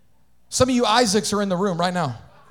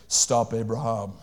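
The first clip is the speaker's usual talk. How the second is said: angel voice; 7 semitones lower than usual. Acted. Angel's voice in the story.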